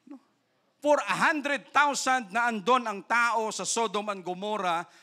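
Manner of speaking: normal talking voice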